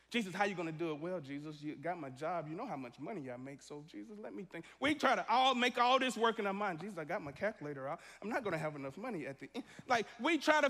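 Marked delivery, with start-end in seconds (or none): 0.1-4.6 worried mutter. Retold or imitated. Worrier voiced praying anxiously. 6.8-9.7 fretful fast mumble. Retold or imitated. Worrier with calculator praying.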